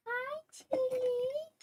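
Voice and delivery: high, slow sing-song hello; drawn-out baby-talk hello to Tilly; cooing greeting. Acted. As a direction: coo it like greeting a pet.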